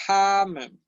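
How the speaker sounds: "Tamen" high and loud, strong puff on T; aspirated T. Shown as a demonstration. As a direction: expel air on the T of "Tamen". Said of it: "estoy expulsando aire acá en la T".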